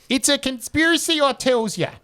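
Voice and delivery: hammed-up conspiracy theorist; conspiracy nut. Host reading drummer boy's comment as a hammed-up crank.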